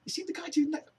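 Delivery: high squeaky imitation of a stunned friend; incredulous friend. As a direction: squeal it in mocking disbelief. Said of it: "And it was just like".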